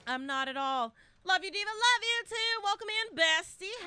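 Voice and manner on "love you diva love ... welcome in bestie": high, bubbly hello to a friend; gushing greeting. Real, not acted. Greeting a chat friend with a high gush.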